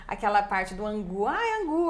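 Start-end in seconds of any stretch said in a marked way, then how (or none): 1.3-1.9 put-on high, lilting baby-talk intonation; sing-song parent voice; mother playing with baby. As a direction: coo it playfully high and louder to a baby. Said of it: "É a entonação".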